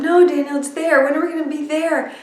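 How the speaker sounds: a hint of a know-it-all kid correcting; correcting big brother. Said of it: "Max immediately starts to correct him".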